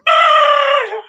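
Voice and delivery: high, loud shriek of mock alarm; mock panic scream. Real, not acted. To viewers on the Christmas countdown, a mock panic shriek. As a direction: yelp as if the date scares you. Hearing ten months until Christmas.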